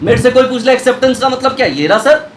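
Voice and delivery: ordinary talk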